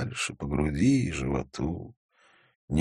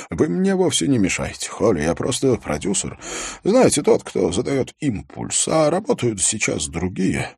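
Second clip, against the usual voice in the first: higher-pitched, lightly flustered reassurance; eager anxious producer; 6 semitones higher than usual. Acted. Producer assuring policeman he is no bother, mildly anxious. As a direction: hasten to reassure, pitch lifted.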